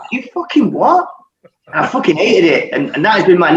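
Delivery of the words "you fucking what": high-pitched retold retort; indignant disbelief. Player retelling his reply to being called Gandhi, mock indignation. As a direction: act out being taken aback by a jibe.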